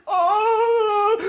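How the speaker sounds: long high-pitched comic cry; mock anguished wail. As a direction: wail in hammed-up grief. Guard reacts to mouse's death.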